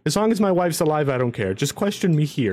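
high pleading voice; begging for my life. Acted. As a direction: beg desperately to stay. Noble pleads to stay with wife.

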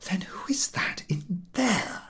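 slow, soft whisper; hushed demand. A narrator's reading. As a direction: whisper so he won't wake. Women whisper by sleeping stranger.

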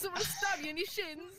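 high-pitched mock British accent; badly faked British voice, verging on French. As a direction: put on a dubious British accent. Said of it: "That was not a British accent".

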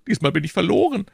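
high-pitched wail of doom; sighed despair. A narrator's reading. Grocer sure that he is lost, high despairing lament. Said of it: "seufzte der Krämer".

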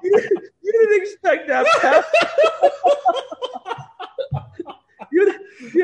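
laughing hard, pitch high. Real, not acted. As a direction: laugh right through the words.

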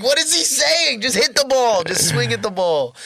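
mock yell of confusion at the ball game; exasperated high shout. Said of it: "So the whole time you're like".

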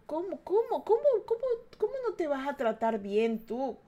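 disbelieving, pitch climbing high; incredulous. Acted. Asking herself how she could not, incredulous.

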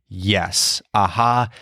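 usual speaking voice

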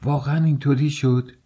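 high-pitched cry of disbelief, mild; exclaiming. A narrator's reading. Mother reacting to news of the quarrel, high startled exclamation. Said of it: "پولچریا فریاد زد".

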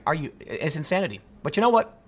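usual speaking voice

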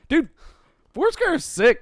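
high loud enthusiasm; excited. Real, not acted. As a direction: gush about it with a grin. Enthusing about game.

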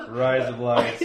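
slightly loud Australian-accent attempt. Shown as a demonstration. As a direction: giggly try at an Australian accent.

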